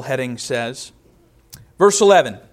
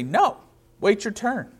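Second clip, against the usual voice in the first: mock scolding father; 5 semitones higher, 3 dB quieter than usual. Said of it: "The father doesn't say".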